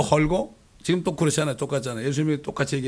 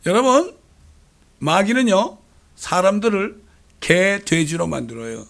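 high, loud call to the congregation; fiery sermon warning; preaching at full force; 4 semitones higher, 5 dB louder, slower than usual. Acted. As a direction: rouse the flock with a warning.

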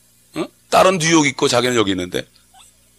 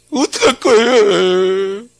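crying plea; 9 semitones higher, 4 dB louder, much slower than usual. Acted. Elder crying to God.

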